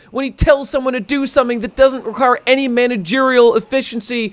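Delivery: mock the fans swooning over their hero; high-pitched mock fan gushing, dragged out. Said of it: "the whole fucking One Piece reading world".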